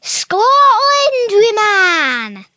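very loud, high Scottish impression; Scottish accent. Shown as a demonstration. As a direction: yell it out like a hammed-up Scot. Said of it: "my favourite impression of Scottish".

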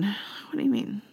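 breathy hushed question; hushed whisper. Real, not acted. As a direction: whisper it in disbelief. Whispered question about missing flag.

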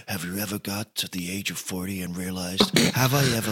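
low, soft mock movie-trailer voiceover; shitty voiceover. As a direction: ham up a cheesy trailer narrator. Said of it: "this shitty voiceover".

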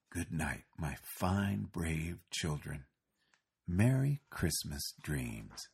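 soft, slow goodnight; softly. A narrator's reading. Santa softly bidding the sleepy children good night. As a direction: ease the children gently to sleep. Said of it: "he heard Santa say softly".

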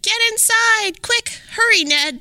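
high urgent warning shout; knights shouting. A narrator's reading. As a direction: call everyone inside before the dragon lands. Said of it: "The knights all shouted".

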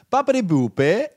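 bad Italian accent. Shown as a demonstration. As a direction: cue up a cartoonish Italian accent. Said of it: "like, for Italian, it's".

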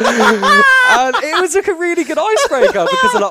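loud, high, helpless laughter in the words; real cracking up, voice shooting up; laughing while talking. Real, not acted. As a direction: struggle to tell the story through laughter. Cracking up at own story.

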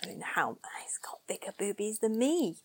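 hushed, whispery mock confidence; whispered aside. Joking to co-hosts about the actor's physique, whispered.

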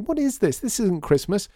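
put-on very high childlike outburst; upset cry. A narrator's reading. Lydia rejecting the new display, crying out. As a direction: protest in a high, distressed voice. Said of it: "cried Lydia".

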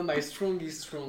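slow mock-English accent, amused; drawn-out French-accented English; imitated English accent. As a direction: mimic a friend's clumsy English accent. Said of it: "avec cet accent".

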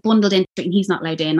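normal talking voice

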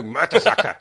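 Mertesacker said with a German accent. Shown as a demonstration. Mocking the commentator who won't Germanicise the name, German accent. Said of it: "He won't say it in a German accent".

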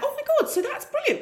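high-pitched, gushing enthusiasm; retold delight. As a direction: squeal it like thrilled parent recalling good news. Retells her delighted reaction to tutor.

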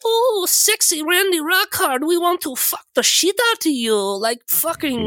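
high, hammed-up swooning; mock lusty voice. GM mocking the players as smitten fans, in a high hammed-up squeal.

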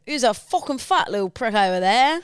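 London lad attempt that sounds Irish; accent slipping into Irish. Shown as a demonstration. Copying co-host's London line, accidentally Irish accent. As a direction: parrot the London accent, landing a bit Irish. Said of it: "a bit Irish again".

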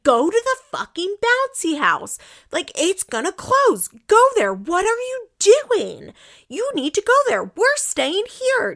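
mock snapping. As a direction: imitate the husband snapping over a bouncy house. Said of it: "totally snaps on her".

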